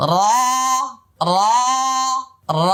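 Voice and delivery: র curled and full, lips unrounded; full-mouthed র demo; heavy Arabic ra. Shown as a demonstration. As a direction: roll a thick র. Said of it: "আমি আবার বলছি".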